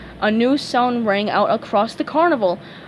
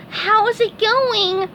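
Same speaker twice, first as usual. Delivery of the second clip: high, very slow meek voice; nervous greeting; 12 semitones higher, 3 dB louder, much slower than usual. A narrator's reading. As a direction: greet him sheepishly.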